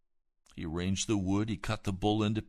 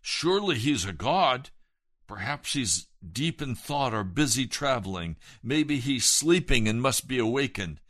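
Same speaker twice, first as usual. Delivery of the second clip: taunting; 4 semitones higher, 7 dB louder than usual. Acted. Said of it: "Elijah begins to taunt them".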